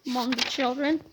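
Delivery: usual speaking voice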